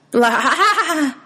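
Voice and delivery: high, wavering goat bleat; high irritated "blaa". A narrator's reading. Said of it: "more of an irritated bleat".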